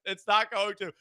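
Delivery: ordinary delivery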